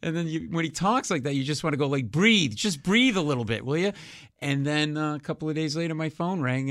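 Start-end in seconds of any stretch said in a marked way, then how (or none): 2.1-3.9 mock exasperation. Mock plea to fast-talking Leno.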